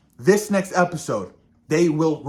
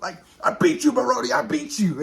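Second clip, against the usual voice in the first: Oz upset; 6 semitones higher than usual. Retold or imitated. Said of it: "He's like".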